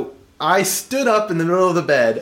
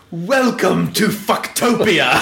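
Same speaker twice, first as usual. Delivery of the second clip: slow grand decree through laughter; roaring tyrant king; 3 semitones higher, much slower than usual. Retold or imitated. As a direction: proclaim it like a mock king. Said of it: "I stood up and went".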